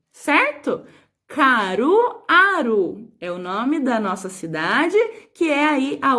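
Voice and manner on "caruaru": slow, loudened dictation with drawn-out syllables; ditado pausado. Shown as a demonstration. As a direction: dictate the town's name slowly, pitched up. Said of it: "quarta e última palavra". (ditado lento, com sílabas alongadas)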